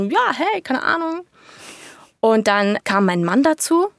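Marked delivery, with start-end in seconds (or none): 0.0-1.2 breezy high carefree shrug. Retold or imitated. Mother recalls shrugging off news before her caesarean, breezy. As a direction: toss it off lightly, unbothered. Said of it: "da war ich immer noch so voll gut drauf und dann so".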